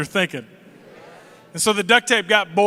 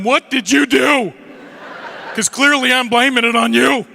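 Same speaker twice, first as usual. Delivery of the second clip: yelling at brother; 3 semitones higher, 4 dB louder than usual. Retold or imitated. Kid yelling at older brother Sam in the back seat, loud accusing. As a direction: blame him at full volume. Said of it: "I yell at him".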